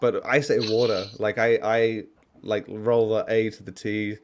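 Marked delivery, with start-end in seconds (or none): 0.0-1.1 water said the Australian way, A rolled into the T. Shown as a demonstration. Contrasting his own accent, water with the A rolled to the T. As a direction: say water the way he naturally does. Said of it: "You have to exaggerate the A".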